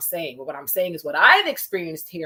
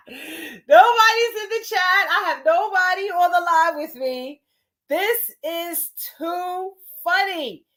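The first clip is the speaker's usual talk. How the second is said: amused disbelief; 9 semitones higher, 5 dB louder, slower than usual. Real, not acted. Laughing at empty chat.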